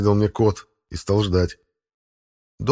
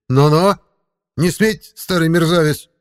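loud high indignant cry; angry cat; 8 semitones higher, 5 dB louder than usual. Acted. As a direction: protest furiously. Cat protests angrily.

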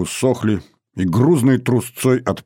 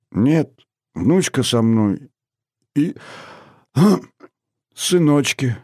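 put-on very old man; 6 semitones higher, slower than usual.

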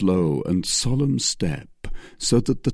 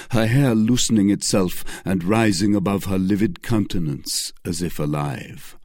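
ordinary delivery, nothing marked

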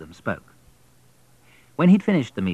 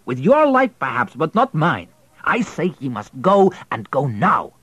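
raised, higher, urgent man's voice; louder, pressing the wife to get rid of him; a husband insisting in a raised voice; Pyotr, urgent husband; 6 semitones higher, 5 dB louder than usual. Acted.

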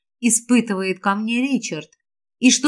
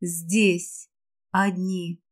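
hushed; 6 semitones lower, 8 dB quieter, much slower than usual. A narrator's reading. Intimate moment, hushed words.

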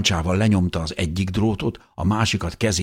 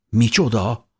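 startled question, pitched up; stunned; 6 semitones higher, 3 dB louder than usual. A narrator's reading. Beloved hears they must flee at once, stunned. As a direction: react with shock, voice jumping higher. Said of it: "nézett rám döbbenten".

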